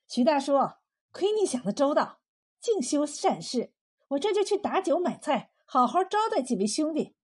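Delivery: pleased, hospitable woman; delighted thanks. Acted. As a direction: welcome the helper with delight. Delighted wife gushes thanks to neighbour.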